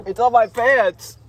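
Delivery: shrieking in dismay; high, loud dismayed cry. Real, not acted. Drink spilled on pants.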